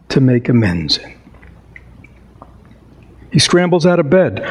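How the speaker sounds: expressive storybook narration; story reading. A narrator's reading. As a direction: read it like a classic tale by the fire. Reads Christmas Carol aloud.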